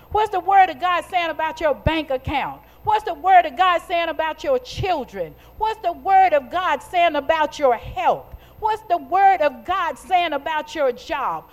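emphatic, repeating preacher's cadence; rhythmic preaching. Real, not acted. Preacher asking what God's word says about you, emphatic preaching. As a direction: drive each repeated phrase home like a preacher.

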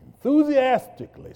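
eager groom's excitement; excited burst. Acted. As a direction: blurt it out with joy. Narrator acts groom's eagerness.

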